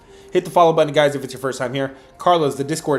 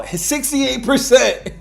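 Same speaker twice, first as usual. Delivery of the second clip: drawn-out, much higher hammed-up hype; hype call; 11 semitones higher, 5 dB louder, much slower than usual. Host hypes his sixty-eight percent record to viewers, slow high hammed-up hype call. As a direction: sell the number like a slow, high announcer.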